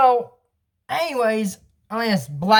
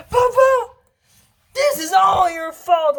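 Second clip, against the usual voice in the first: angry accusing shout; 10 semitones higher, slower than usual. Retold or imitated. Daughter blaming her papa, high mock shout. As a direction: throw the blame at him with a yell.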